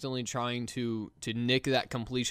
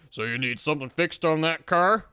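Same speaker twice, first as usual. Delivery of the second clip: mock rural accent, louder; 2 semitones higher, 6 dB louder than usual. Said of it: "something really hicky".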